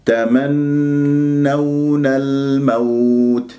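very slow "تمنون الموت", long hum then gliding aw; supple, smooth waw. Shown as a demonstration. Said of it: "بهذه الطريقة باليونة وسلاسة".

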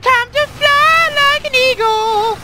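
very high, slow whoop; soaring cry. Real, not acted. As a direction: belt it out as you launch. Rocket jump.

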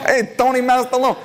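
high, hammed-up Italian accent. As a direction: call it out in a mock Italian accent. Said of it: "Italian voice".

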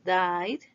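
"died" as one syllable, "dide"; voiced final D. Shown as a demonstration. Teacher says repeat after me, voiced final D. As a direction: end "died" on a vibrating d, no e. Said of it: "es con vibración".

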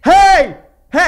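loud sudden call for attention; shouting hey. Real, not acted. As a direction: yell to grab everyone. Yelling at live chat.

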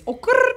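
high rolled-r trill; imitated catchphrase trill. Host giving an easy guessing-game clue, high trilled catchphrase. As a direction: trill the end like a celebrity catchphrase. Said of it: "this one's easy".

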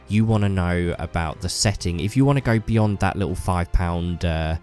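ordinary talk

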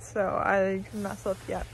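ordinary talk

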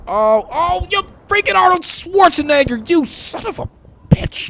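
frustrated high-pitched yell; outraged shriek. Real, not acted. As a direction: screech at the player who killed you. Killed again by rival.

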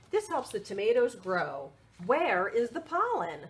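lively picture-book read; reading to children. Acted. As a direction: read it out brightly for little ones.

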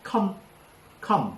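"คอม" alone, with a clear open aw vowel; sounded-out vowel. Shown as a demonstration. Teacher drills the aw vowel for student, "คอม" isolated. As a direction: isolate "คอม" as one separate syllable. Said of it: "สระออกครับ".

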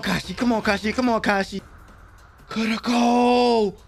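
ordinary talk, nothing marked

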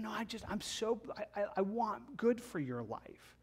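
soft, earnest plea; Paul gently begs the Galatians not to turn back; soft pleading Paul. Pretend.

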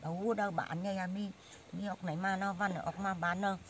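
ordinary delivery

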